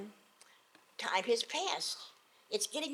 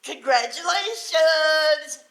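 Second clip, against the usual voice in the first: loud, slow, drawn-out high shout; a fool loudly congratulating firemen; cheery shout; 5 semitones higher, 15 dB louder, much slower than usual. Acted. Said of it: "here comes the mission".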